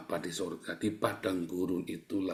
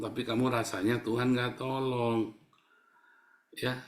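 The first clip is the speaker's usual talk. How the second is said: normal talking voice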